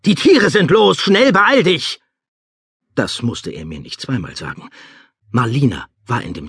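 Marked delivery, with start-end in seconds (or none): 0.1-2.0 high, alarmed warning yell; frantic high-pitched call; urgent shout. Acted. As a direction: yell at someone to hurry, voice pitched right up. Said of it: "rief er".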